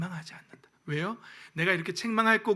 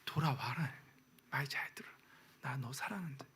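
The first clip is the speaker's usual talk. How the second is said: lower, soft unvoiced whisper of love; tender whisper; 4 semitones lower, 11 dB quieter than usual. God to his wayward children, tender whisper. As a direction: call the child home gently.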